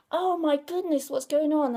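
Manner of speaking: a small, wide-eyed gasp of confusion; baby's bewilderment. Pretend. A tiny baby left alone in the dark, mock alarmed.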